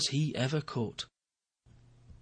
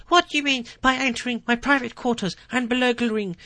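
much higher, louder flustered bluster; a rattled president puffing himself up; blustering official; 12 semitones higher, 8 dB louder than usual. Acted. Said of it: "he used it to bluster".

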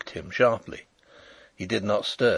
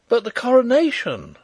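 high, loud cry of alarm; horrified cry; 13 semitones higher, 7 dB louder than usual. A narrator's reading. Narrator realising the King will miss coronation, horrified cry. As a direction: cry out aghast, pitch soaring. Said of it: "I cried in horror".

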